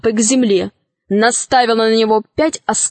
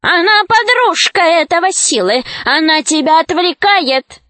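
high-pitched, accusing witch; witch crying out; 7 semitones higher than usual. A narrator's reading. Witch denounces Alisa to the dragon, high cry. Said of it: "закричала ведьма".

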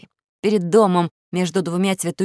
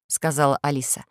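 usual speaking voice

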